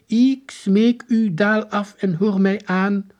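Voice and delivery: high, loud, slow archaic soldier; ceremonious high-pitched proclamation; strange voice. A narrator's reading. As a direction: declaim slowly like a stiff old-time general. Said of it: "Een vreemde stem zei".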